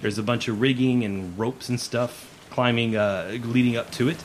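ordinary talk, nothing marked

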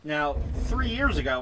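ordinary delivery

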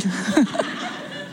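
real amused laugh. Real, not acted. Preacher after joking about marriage, real laugh. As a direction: laugh at your own joke.

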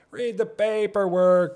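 loud, slow, high exasperated scold. Pretend. Scolding the neighbor to read the notice, loud and slow. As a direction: bark it drawn out at him.

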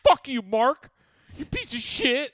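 high, loud outraged yelling; jealous brother's shouted outburst; Paul Wahlberg. Acted. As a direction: scream at your brother in hammed-up fury. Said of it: "imagine being Paul Wahlberg ... You're like".

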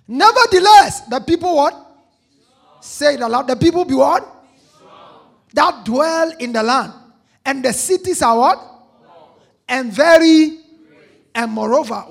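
loud, high preacher calls to the crowd; preaching shout. Acted. Preacher calling to the congregation, loud and high. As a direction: boom the questions out to the congregation. Preaching at full force, call and response.

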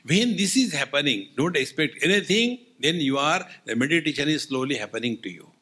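normal talking voice

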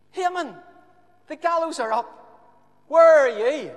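high, loud worried wife; Haman's wife phoning him from home; wife on the phone. Acted. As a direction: call him, anxious, on the mobile. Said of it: "she dials his number and says".

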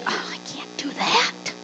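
soft, breath-only protest; timid, whispered objection; reluctant Moses. Acted. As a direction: shrink back from the call in a hushed voice. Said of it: "what did Moses say?".

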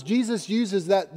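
usual speaking voice

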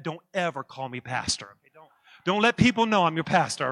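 ordinary delivery

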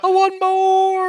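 long, drawn-out high yell; mock demanding wail. Co-host clamours again for the preview, mock demanding wail. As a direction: wail it slowly and loudly. Mock begging for more of episode.

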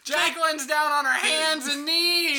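high, excited play-by-play; frantic commentator. Acted. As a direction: call it like live breaking chaos.

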